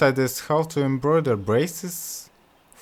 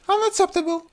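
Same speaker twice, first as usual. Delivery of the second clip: very high, prim princess voice; haughty princess. Acted. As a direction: dismiss them coolly. Princess rejects suitors.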